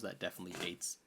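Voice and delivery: ordinary talk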